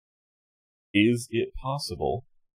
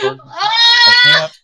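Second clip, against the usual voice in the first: very high, loud squealing cry; panicked shriek; 15 dB louder than usual. Pretend. Player faces a dreaded roll, panicked shriek.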